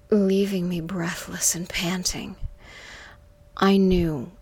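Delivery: ordinary delivery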